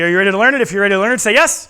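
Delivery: put-on loud, coaxing call to the kids; kids party magician. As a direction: pump the crowd up for an answer. Calling kids to shout yes.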